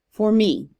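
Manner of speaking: light "for", strong "me"; iambic emphasis. Shown as a demonstration. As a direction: punch "me" to close the line. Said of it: "read it with more emphasis".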